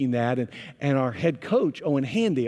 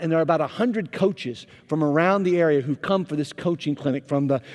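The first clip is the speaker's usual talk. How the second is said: ordinary delivery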